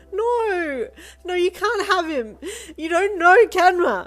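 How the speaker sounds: high, loud desperate cries; screaming wail, slow on the last line. Acted. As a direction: wail in tearful protest. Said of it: "you wailed".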